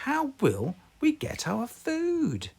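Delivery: high worried mother voice; fretting mother pig; Mummy Pig voice. Acted. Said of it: "Mummy Pig said".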